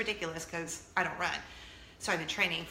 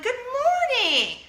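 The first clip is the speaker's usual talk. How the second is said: much higher, louder, slow sing-song good morning; over-eager high morning greeting; chirpy greeting; 10 dB louder, much slower than usual. Retold or imitated. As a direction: sing it out brightly to a stranger. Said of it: "I'm like".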